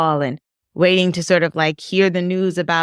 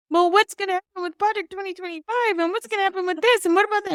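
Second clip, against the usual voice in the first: put-on much higher, childlike worried rush of questions; child's voice; 14 semitones higher, 3 dB quieter, faster than usual. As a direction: imitate a ten-year-old son firing anxious questions. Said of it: "he was like".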